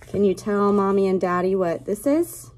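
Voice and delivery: usual speaking voice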